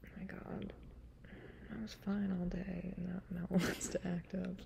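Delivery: ordinary talk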